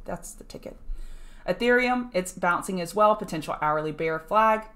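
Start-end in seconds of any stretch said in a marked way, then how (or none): 0.1-0.8 soft unvoiced whisper of "that's the ticket"; whispered catchphrase. Muttering a catchphrase to himself, whispered. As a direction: breathe it out like a private joke.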